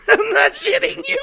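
words squeezed out through high, wheezy laughter; laughing disbelief. Real, not acted. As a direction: laugh helplessly at how absurd it was. Recalling the third customs check.

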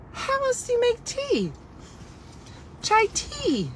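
high, squeaky disbelief. Retold or imitated. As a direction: pitch way up in shock at the question. Stunned reply retold.

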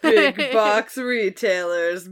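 slow, drawn-out mock villain announcement; playful supervillain. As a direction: intone it like a cartoon villain. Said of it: "super villain arc laugh".

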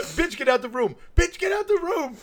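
high, loud mock warning; imitated yelling at the screen. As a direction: shout urgently at a movie character. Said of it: "yelling at the screen".

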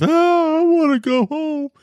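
imitating himself crying on a video call, very high; mock crying whine. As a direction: wail it like a homesick kid. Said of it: "I cried".

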